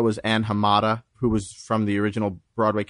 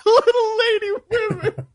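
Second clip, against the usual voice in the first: laughing repeat. Real, not acted.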